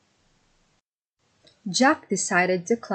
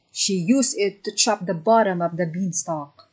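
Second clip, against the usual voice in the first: ordinary delivery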